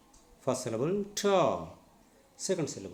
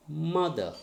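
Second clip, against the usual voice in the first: Mother said as a teacher's demo, weight on MO; stress on first syllable. Shown as a demonstration. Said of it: "stress should be on the first syllable".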